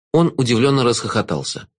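ordinary delivery

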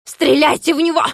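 high, loud spitting shout; spluttering yell. A narrator's reading. Klops ordering the shot, spluttering yell. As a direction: spit the order out. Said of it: "кричал, брызгая слюной".

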